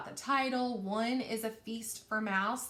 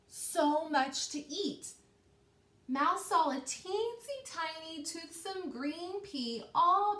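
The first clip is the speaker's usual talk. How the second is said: higher, animated read-aloud voice; lively story narration; 5 semitones higher than usual. A narrator's reading. Teacher reading the feast to her class, animated storytelling. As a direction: make the leftovers sound tempting to the kids.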